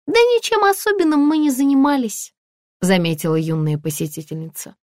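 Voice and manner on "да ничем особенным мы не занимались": put-on high-pitched young girl's voice; high, light girlish line; young visitor. A narrator's reading. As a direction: voice a young shopper, pitched well up. Young female visitor answers in the fitting room.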